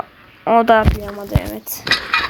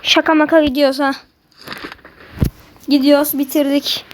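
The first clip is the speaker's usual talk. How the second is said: usual speaking voice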